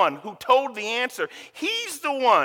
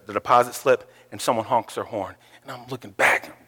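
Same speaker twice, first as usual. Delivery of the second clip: usual speaking voice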